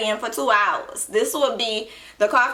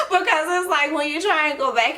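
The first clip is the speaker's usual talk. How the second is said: laughing; 6 semitones higher than usual. Real, not acted. Laughing recalling nursing school blur.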